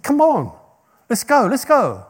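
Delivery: high, impatient urging of a donkey; impatient Balaam urging. Retold or imitated. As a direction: hurry the stubborn beast along.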